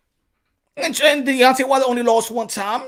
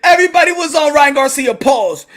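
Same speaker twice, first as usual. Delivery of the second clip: loud, higher mocking shouts; mock angry yell; 5 semitones higher, 6 dB louder than usual. Fans shouting that Ryan is ducking, mock yell. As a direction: jeer like a furious fan.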